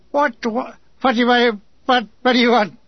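stammering, curt officer; brusque, stuttering demand. A narrator's reading. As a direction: curtly splutter the repeated words. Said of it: "he said curtly".

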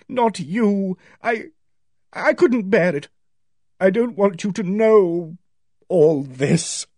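high-pitched anguished plea; distraught, near sobbing. A narrator's reading. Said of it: "She was sobbing now".

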